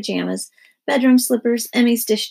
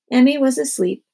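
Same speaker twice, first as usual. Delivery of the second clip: plain reading voice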